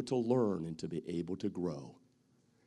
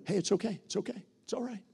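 reassuring comfort; 9 semitones higher, faster than usual. Retold or imitated. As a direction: comfort someone who dropped the ball. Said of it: "what do we do".

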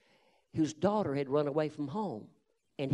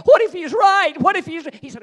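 much higher, much louder cry; anguished shout; 18 dB louder than usual. Shown as a demonstration. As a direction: cry out the doubt. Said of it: "it hit me again".

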